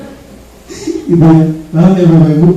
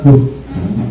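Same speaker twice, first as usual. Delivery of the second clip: resentful mutter; 4 semitones lower, 6 dB quieter than usual. Acted.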